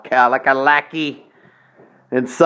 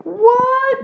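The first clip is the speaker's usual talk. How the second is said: a high squeaky mock What; mock shock. Host reacts to his own gossip with a squeaky mock What. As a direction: yelp What in fake disbelief.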